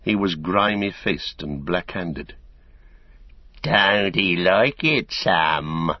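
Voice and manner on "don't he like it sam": slow, sneering bully's jeer; a surly mill hand jeering at Sam, slow and sneering. A narrator's reading. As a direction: drag it out to mock him. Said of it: "he sneered".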